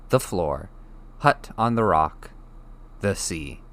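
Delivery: plain reading voice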